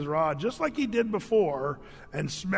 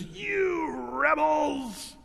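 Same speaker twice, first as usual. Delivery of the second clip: high, long-drawn mock yell of rebuke; hollered scolding, stretched and high-pitched; angry yell; 8 semitones higher, much slower than usual. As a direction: draw the words out in hammed-up fury, pitched high. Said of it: "he yells at the people".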